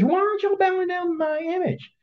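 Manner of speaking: high put-on voice of an indignant king; angry king. The king confronting the three men, high and indignant. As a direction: demand it up high like a stung ruler.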